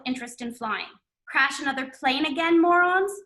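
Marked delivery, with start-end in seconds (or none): 1.3-3.1 rising mocking jab, louder at the end; mocking taunt. A narrator's reading. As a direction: taunt them. Sean mocks friends.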